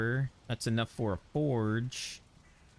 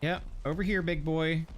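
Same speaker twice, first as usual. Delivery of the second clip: taunting call; 6 semitones higher than usual. Acted. Calling a mob over.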